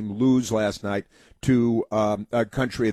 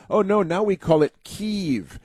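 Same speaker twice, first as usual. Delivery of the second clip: higher, prissy mock voice of the media; mocking media quote; 8 semitones higher than usual. Mocking media people correcting him, prissy mock voice. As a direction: lampoon a smug correction. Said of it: "Democrats told us".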